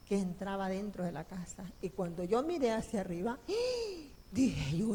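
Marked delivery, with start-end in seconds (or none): 3.4-4.1 sharp high gasp of awe; startled gasp. Retold or imitated. She sees the heavens break open, sharp gasp. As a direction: gasp as if seeing the sky split.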